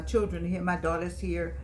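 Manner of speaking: normal talking voice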